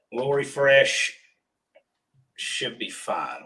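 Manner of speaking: usual speaking voice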